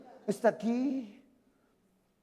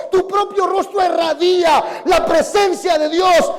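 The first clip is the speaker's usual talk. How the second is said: loud, impassioned sermon delivery; a pastor pressing his flock to build an altar; preaching at full force; 6 semitones higher, 10 dB louder than usual. Acted. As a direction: hammer each line home to the congregation. Pastor preaching loudly to the congregation.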